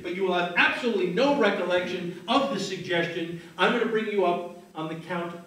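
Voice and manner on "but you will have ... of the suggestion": ordinary talk, nothing marked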